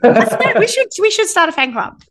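high, eager fan-club squeal; excited. Real, not acted. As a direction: gush like a superfan. Joking fan enthusiasm.

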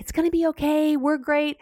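high, chirpy false reassurance; breezy cheer. Shown as a demonstration. Parent skipping empathy with chirpy high reassurance. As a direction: brush it off cheerfully.

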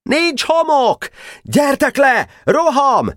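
very high battle cry; shouted call. A narrator's reading. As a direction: call high between fort and comrades mid-battle. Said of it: "Barabás lekiáltott az erődről". (magas hangú csatakiáltás)

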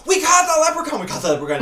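high, loud excited shout of schoolkids; mock kids yelling they caught it. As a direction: yell it like a thrilled child.

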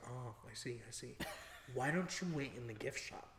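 low, very soft deadpan reply; calm friend. Retold or imitated. Friend answering the panicked storyteller, hushed and calm. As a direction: gently talk down a panicking friend.

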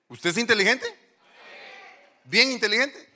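fuerte y agudo, subiendo al final; proclámalo a todo el público para convencerlo; exhortación enfática. Real, not acted. Predicador anima al público, subiendo énfasis. (ánimo insistente en voz alta)

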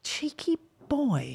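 high whispered tease; breathy whispered scolding; whispering. Real, not acted. Whispered tease about George.

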